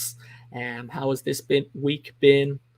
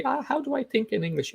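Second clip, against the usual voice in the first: puzzled learner voice; 12 semitones higher than usual. Retold or imitated. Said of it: "They say".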